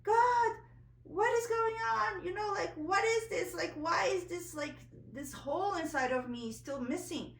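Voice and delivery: loud, high, desperate cry at the sky; screaming at God. Retold or imitated. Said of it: "like, screaming at God".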